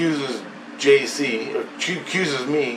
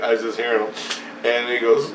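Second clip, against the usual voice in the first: usual speaking voice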